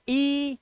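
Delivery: ई held as a pure high "ee"; long ee sound. Shown as a demonstration. Teacher isolating the sound ई for learners, long high ee. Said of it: "अब देखो sound देखना".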